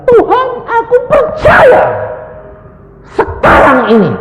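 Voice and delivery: high, loud imitation of a woman's cry; acted full-voice yell to God; crying out in faith. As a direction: shout it wildly as the praying wife. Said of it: "Dia teriak manggil suaminya".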